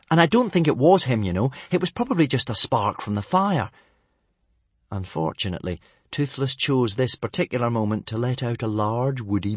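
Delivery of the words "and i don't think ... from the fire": higher, quick excuse; hurried. Acted. Son defending his dragon to his father, hurried. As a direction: rush out the defence. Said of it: "Hiccup said hurriedly".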